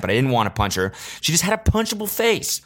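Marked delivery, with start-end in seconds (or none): none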